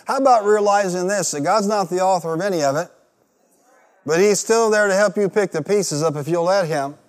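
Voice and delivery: loud and high, driving it home; preaching at full force. Real, not acted. Preacher urges the congregation, loud and high. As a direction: shout the truth to the church. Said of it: "Come on, say it".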